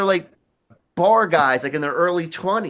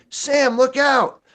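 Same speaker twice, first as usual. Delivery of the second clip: high, drawn-out call of warning; mock warning shout; 10 semitones higher, 3 dB louder, slower than usual. Classmate warns Sam of the chair, high mock yell. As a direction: call out as the alarmed bystander.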